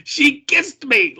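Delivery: high, drawn-out mock yell, half laughing; amazed yell. Amazed king yelling to his wife, laughing imitation. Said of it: "he yells to his wife".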